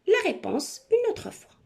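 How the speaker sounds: ordinary talk, nothing marked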